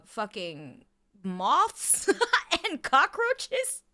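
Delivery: slow, high, laughing through the words; amused incredulity; laughing disbelief. Real, not acted. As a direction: crack up at the list. Listing absurd allergens, laughing.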